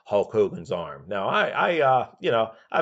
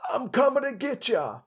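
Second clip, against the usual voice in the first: much higher, louder wrestler's yell out the car window; mock scream; 3 dB louder than usual. Piper hyping himself up while driving into a state, high mock yell. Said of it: "he would scream out".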